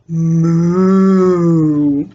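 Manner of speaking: long drawn-out cow moo. A narrator's reading. Storyteller voices the cow for young listeners, long moo. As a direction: moo slowly like a cow, swelling in the middle. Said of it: "The cow says".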